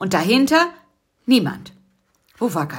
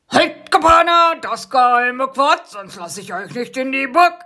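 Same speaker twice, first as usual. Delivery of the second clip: growling guard wasp; 6 semitones higher than usual. Acted. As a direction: stop them at the gate like a gruff sentry. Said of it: "knurrte eine Wespe".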